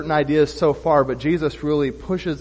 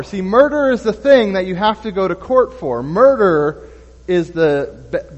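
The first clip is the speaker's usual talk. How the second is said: louder, higher, driving sermon; preaching at full force; 5 semitones higher, 6 dB louder than usual. Acted. Preacher pressing the point on murder to his church, loud and forceful. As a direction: preach it hard to the back row.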